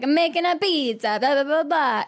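a quick hint of a thick Jersey pasta guy; Jersey accent. Friend mimics TikTok pasta guy, mock Jersey accent. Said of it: "that big, thick, like, Jersey accent".

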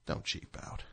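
soft whispered aside. Real, not acted. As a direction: breathe it as a secret.

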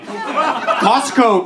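usual speaking voice

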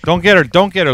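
loud urgent call; shouting. Pretend. Yelling at a dog to stay.

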